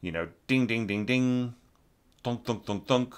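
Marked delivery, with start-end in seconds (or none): 0.5-1.5 imitating marimba hits, ding ding; sung ding. Shown as a demonstration. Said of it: "come in forte with an accent". 2.2-3.1 imitating dull mallet thunks, softer; soft thunk sounds. Shown as a demonstration. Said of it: "Just come in forte with an accent".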